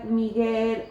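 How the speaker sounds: usual speaking voice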